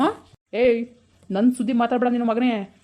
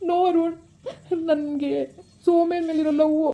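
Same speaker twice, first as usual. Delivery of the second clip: slow, hammed-up filmy plea, higher; milk the romantic line like a movie hero; over-the-top film melodrama, pitched up; overacting; 4 semitones higher, slower than usual. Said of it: "ಏನ್ over acting ಮಾಡ್ತಾ ಇದೀಯ ನೀನು".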